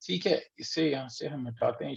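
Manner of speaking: normal talking voice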